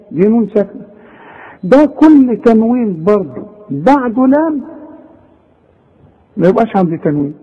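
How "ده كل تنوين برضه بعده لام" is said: ordinary talk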